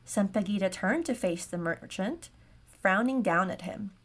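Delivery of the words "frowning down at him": ordinary delivery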